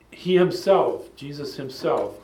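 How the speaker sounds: "he himself" stretched slowly with weight; emphatic stress on himself. Shown as a demonstration. Teacher showing the Greek emphasis, emphatic "he himself". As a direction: lean hard and slow on "he himself". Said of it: "it's emphatic".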